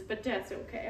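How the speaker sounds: normal talking voice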